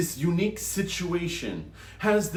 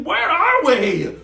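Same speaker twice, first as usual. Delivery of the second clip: startled cry; 7 semitones higher, 9 dB louder, slower than usual. A narrator's reading. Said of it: "And Mad asking".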